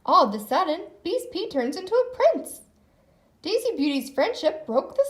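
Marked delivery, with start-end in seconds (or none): none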